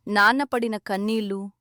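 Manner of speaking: plain reading voice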